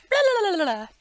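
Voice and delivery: high, loud silly blah blah; mock babble. Vlogger mocking her own rambling, high silly babble. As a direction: babble nonsense playfully. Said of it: "I'm just like".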